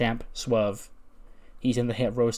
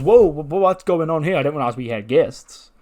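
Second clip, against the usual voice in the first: higher, louder stammered surprise; startled old man; 4 semitones higher, 8 dB louder than usual. Acted. Old bar owner finds guests.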